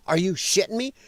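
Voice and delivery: ordinary talk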